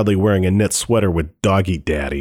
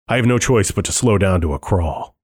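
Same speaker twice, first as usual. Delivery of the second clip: usual speaking voice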